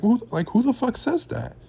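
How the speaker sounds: who could say that, sheer disbelief; incredulous outburst. Real, not acted. Reacting to Konnan's podcast remark, incredulous high-pitched outburst. Ranting about Konnan's comment.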